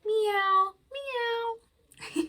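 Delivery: high mewing meows; cat meow. Pretend. Librarian meowing as the cat, high. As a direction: mew like a little cat. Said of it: "a little cat".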